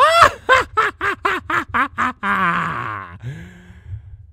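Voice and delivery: loud high evil laugh; villain laugh. A narrator's reading. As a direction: finish with a triumphant evil laugh. Villain ends speech laughing.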